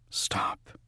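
breathless unvoiced thought; whispered thought to himself; inner voice. A narrator's reading. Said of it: "he thought".

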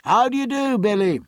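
high, carrying greeting; high, hearty ship-to-ship hail. A narrator's reading. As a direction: call across the water to a friend. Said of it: "called Jack".

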